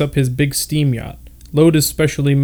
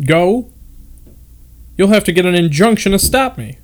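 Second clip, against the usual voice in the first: higher and louder, bristling at the idea; indignant outburst; 6 semitones higher, 4 dB louder than usual. A narrator's reading. Terry retorting to the suggestion he stay behind, indignant and louder. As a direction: throw it back, half outraged.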